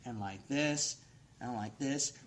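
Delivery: preaching at full force. Acted. Preacher warning the congregation about Satan, shouted. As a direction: pound it out from the pulpit.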